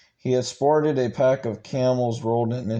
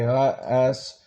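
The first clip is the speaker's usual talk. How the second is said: plain reading voice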